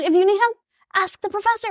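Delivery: high-pitched rebuff, re-enacted; mock exasperation. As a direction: turn down a plea in a high voice. Retold exam quarrel with husband.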